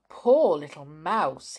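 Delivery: usual speaking voice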